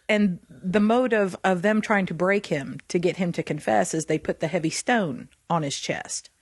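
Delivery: ordinary talk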